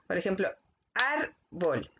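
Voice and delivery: árbol con la A marcada, bajando; acento en la A. Shown as a demonstration. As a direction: say árbol stressing the first A. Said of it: "La palabra se marca en la A". (árbol con énfasis en la primera sílaba)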